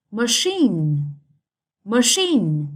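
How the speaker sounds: Machine with the stress on CHINE; second-syllable stress. Shown as a demonstration. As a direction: say Machine with a light MA and strong, falling CHINE. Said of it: "Straight on the second syllable".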